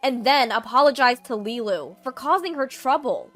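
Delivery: loud, stern laying down of terms; forceful refusal. Acted. Eden sets terms for his sister to stay, stern and loud. Brother rejects offer, lays down terms.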